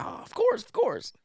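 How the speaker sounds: very high, bright eager yes; eager agreement. Retold or imitated. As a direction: jump at the chance to please him. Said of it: "She's like".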